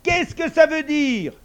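high, loud, drawn-out demand; angry shout. A narrator's reading. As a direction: yell it as an outraged father. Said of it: "cria Papa Saïd".

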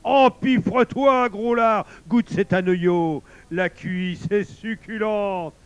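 high, loud, drawn-out goading; gluttonous wolf. A narrator's reading. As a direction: egg a companion on to gorge. Wolf urges bear to gorge. (loup goulu qui pousse à la ripaille)

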